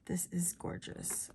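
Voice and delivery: breathy awed whisper. Real, not acted. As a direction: hush it in wonder. Whispered admiration.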